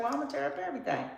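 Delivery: fast, high mock-defiant vow; defiant quote. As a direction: rattle it off fast and high like you mean to wreck it all. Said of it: "So you're like".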